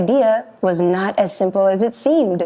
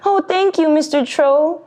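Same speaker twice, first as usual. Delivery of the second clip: high relieved goat; 8 semitones higher than usual. Acted. Relieved goat thanks troll.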